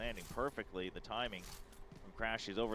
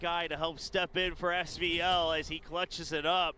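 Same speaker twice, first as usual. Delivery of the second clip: loud, energetic caster recap; esports caster; 5 semitones higher, 7 dB louder than usual. Real, not acted. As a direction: hype the clutch in recap. Caster recap after round.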